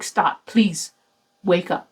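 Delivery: usual speaking voice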